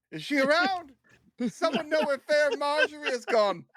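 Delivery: high, theatrical calling out; searching in character. Acted. Character searches for Marjorie aloud.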